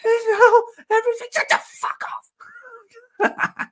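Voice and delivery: very high squeal laughing through the words; mock panicked squeal. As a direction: shriek it while cracking up. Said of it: "And she was like".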